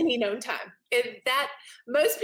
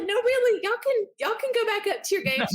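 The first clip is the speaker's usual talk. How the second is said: much higher, half-laughing fluster; flustered mom; 12 semitones higher than usual. Retold or imitated. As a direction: squeak it out embarrassed. Flustered mom shooing kids' friends.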